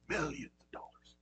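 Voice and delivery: quick whispered aside to the congregation; hushed explanatory aside. Real, not acted. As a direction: drop to a whisper for the gloss. Aside explaining ten thousand talents.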